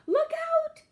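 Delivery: very high, loud warning shout; panicked piglet yell; alarmed cry. A narrator's reading. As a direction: shriek a warning to take cover. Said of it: "he cried, diving behind the sofa".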